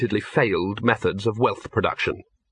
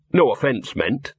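usual speaking voice

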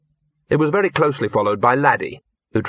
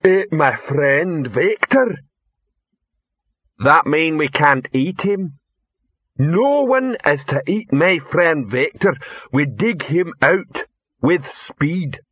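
bellowing troll, slow and higher-pitched; Rock; 5 semitones higher, slower than usual. A narrator's reading. Troll asking about his buried friend, slow bellow. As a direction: drag out each word in broken troll speech, emphatically. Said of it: "Then Rock's voice bellowed".

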